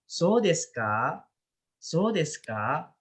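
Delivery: "So desu ka?" said slowly, rising at the end like a question; rising tune. Shown as a demonstration. Teacher demonstrating a questioning rise to the students. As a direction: let "ka" climb upward. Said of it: "itu naik".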